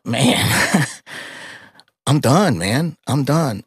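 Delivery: high, tearful voice giving up; tearful despair. Retold or imitated. Widower at the dog's grave, retold tearful despair. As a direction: relive it with the voice rising toward crying. Retelling his breakdown over suicidal despair.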